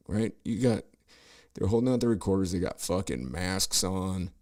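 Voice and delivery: ordinary talk, nothing marked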